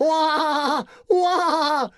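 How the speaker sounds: high whiny wah wah; mock baby cry. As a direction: whine like a crybaby. Mock crying sound.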